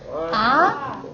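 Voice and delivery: AH rising like a surprised question. Shown as a demonstration. Teacher plays surprised dentist for the class, rising tone. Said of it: "He's very surprised".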